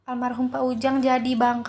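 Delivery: plain reading voice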